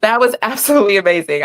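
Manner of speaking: ordinary talk, nothing marked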